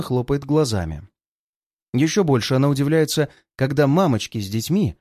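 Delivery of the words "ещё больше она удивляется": usual speaking voice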